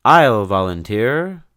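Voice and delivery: loud, bold offer; eager shout. Acted. Hubert volunteering before the frightened crowd, shouting. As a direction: call out to volunteer. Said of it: "Hubert shouted out".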